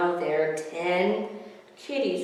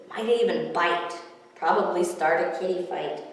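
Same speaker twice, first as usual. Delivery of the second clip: usual speaking voice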